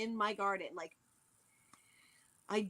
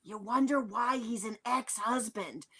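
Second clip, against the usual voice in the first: ordinary talk, nothing marked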